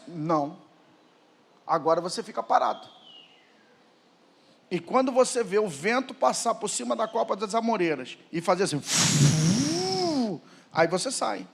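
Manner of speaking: low, soft, measured answer; God. Acted. God instructs David on strategy, low calm reply. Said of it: "Deus disse".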